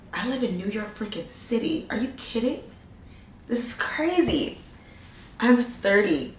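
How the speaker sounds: giddy disbelief; amazed. Real, not acted. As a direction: marvel at it quietly. Marvelling at living in New York.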